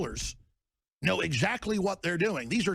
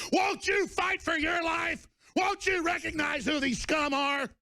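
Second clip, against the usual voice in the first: pitched way up, slow, roaring; furious shout; 11 semitones higher than usual. Real, not acted. Host yelling at his listeners to fight back. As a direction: rouse them to fight, yelling.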